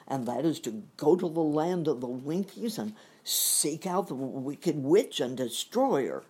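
a brave lion giving the plan; hammed-up lion voice, loud; lion's voice. The lion speaks, quoted in the story.

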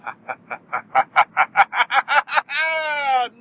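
loud staccato cackle, climbing high; big high mock-villain cackle; mock evil laugh. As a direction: build a hammed-up villain laugh, rising and rising. Mock villain laugh after million-dollar demand.

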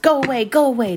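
high, loud shoo at the cow; shooing call. A narrator's reading. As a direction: drive the cow away with your voice.

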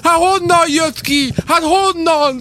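high, slow wailing mock shout; imitated gamer yelling. Host imitating a player hit from the side, high-pitched yelling. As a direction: howl in disbelief like an ambushed player. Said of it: "és üvöltözöl".

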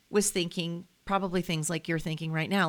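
ordinary delivery

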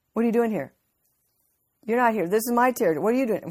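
a territorial demon confronting an intruder; indignant demon. Retold or imitated. Preacher voices the indignant demon.

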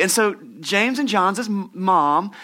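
ordinary delivery

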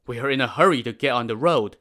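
fast, loud impatient demand; demanding merchants. Acted. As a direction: rush it, push to get through. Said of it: "the merchants demanded".

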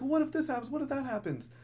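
high-pitched what-if protest; imitating fretful kid. Kid arguing against the gas mask, high mock worry. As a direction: pitch it way up like a panicky, arguing child.

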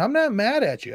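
ordinary talk, nothing marked

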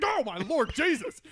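retold shock at a snake leaping out; startled cry. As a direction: shout it like you just got ambushed. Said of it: "it jolted me back in my seat".

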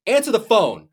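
put-on teen yelling at a phone that won't answer; angry demand. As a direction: bark it at the phone in frustration. Angry demand to absent dad.

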